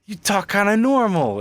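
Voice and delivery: high, swooping, astonished voice; mock amazement. Host mimics people amazed by his normal speech, mock amazement. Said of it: "hilarious when people are like".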